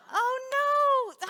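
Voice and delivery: high, loud drawn-out dismay; hammed-up cry of dismay; dismayed wail. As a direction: wail it in story-time despair. Rabbi finds the wrong group.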